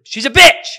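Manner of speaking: high, very loud blurted insult; defiant shout. Real, not acted. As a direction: blurt it out at full volume, not caring. Defiant blurted insult, co-hosts laughing.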